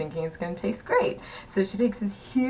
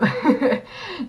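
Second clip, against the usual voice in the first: quick higher laugh; short laugh; 10 semitones higher, 5 dB louder than usual. Real, not acted. Narrator joking about castmates' revenge, short laugh.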